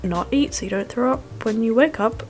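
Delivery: normal talking voice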